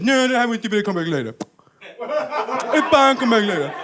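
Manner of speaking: lightly put-on deaf speech; hint of a put-on deaf voice; mock deaf voice. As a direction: brush off the guard in a fake deaf voice. Faking a deaf voice to guard.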